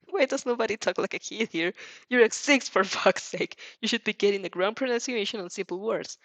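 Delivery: plain reading voice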